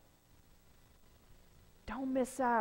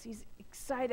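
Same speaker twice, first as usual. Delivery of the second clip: ordinary talk, nothing marked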